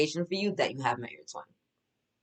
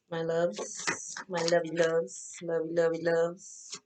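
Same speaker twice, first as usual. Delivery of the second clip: tender cooing; 2 semitones higher, 4 dB quieter, much slower than usual. Real, not acted. Cooing to viewers.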